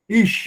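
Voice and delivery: "Ish" ending on a bare sh, no vowel; only the Shin. Shown as a demonstration. Teacher showing a silent Sheva, "Ish" with only the sh. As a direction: say "Ish" closed on the sh. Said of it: "você pronuncia só o Shin".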